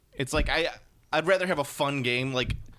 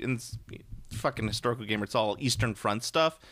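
ordinary talk, nothing marked

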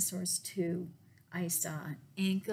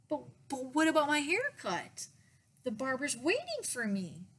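put-on high, louder child's protest; whiny kid objection, pitched up; pleading; 6 semitones higher, 3 dB louder than usual. A narrator's reading. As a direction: plead with your mom. Boy pleading with mom.

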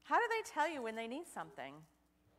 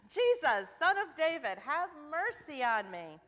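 loud, pleading cry, higher and slowing at the end; 6 semitones higher, 9 dB louder than usual. A narrator's reading. Blind Bartimaeus calling to Jesus from the roadside, loud cry. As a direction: call out over a crowd for mercy. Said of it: "he cried".

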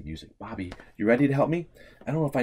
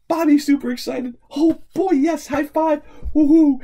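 much higher, louder whoop; thrilled high-five whoop; excited cheer; 12 dB louder than usual. Real, not acted. Celebrating with Bobby.

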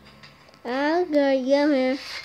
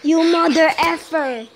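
louder, higher furious yell; 6 semitones higher, 4 dB louder than usual. Real, not acted. Gamer raging at the enemy who escaped, loud furious yell. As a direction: snap it out in real rage.

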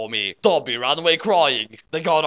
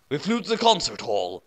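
ordinary delivery

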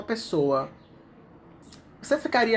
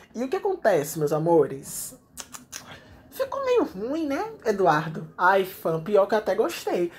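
ordinary talk

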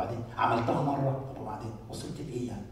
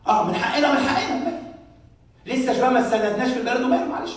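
mocking excuse; 9 semitones higher, 10 dB louder than usual. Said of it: "mocking the people's excuses".

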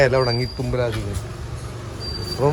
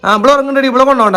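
scolding call; 10 semitones higher, 6 dB louder than usual. Real, not acted. Parent scolding sleepy child.

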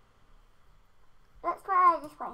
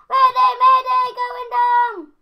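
higher, loud pilot distress call; loud mock mayday; 4 semitones higher, 9 dB louder, slower than usual. Gamer playing a crashing pilot as his plane falls, loud.

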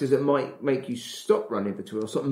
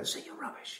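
unvoiced, taunting whisper; 15 dB quieter than usual. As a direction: sneer it softly under your breath. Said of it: "it just kinda whispers in your ear".

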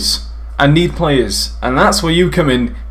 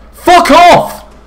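loud, high swear; furious shout; 8 dB louder than usual. Real, not acted. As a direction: explode in anger. Furious yell after quiet take.

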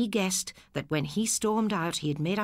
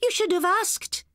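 scolding sister; 14 semitones higher, 3 dB louder than usual. Acted. Sister tells brother off for stealing, high firm reproach. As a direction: press the point firmly.

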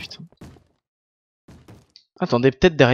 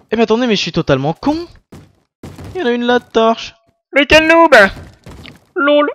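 high, loud, fast self-teasing; excited self-mockery; 10 semitones higher, 9 dB louder than usual. Real, not acted. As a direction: laugh at your own blunder out loud. Realises he missed a torch.

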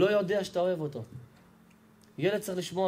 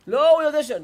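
high, loud cut-off denial; loud protest; 10 semitones higher, 9 dB louder than usual. Retold or imitated. Father cutting in to insist the boy knows, loud and high. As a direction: burst out defending himself.

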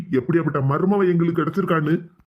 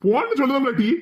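angry order; 7 semitones higher than usual. Acted. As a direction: bark her out of the house. Uncle repeats the order.

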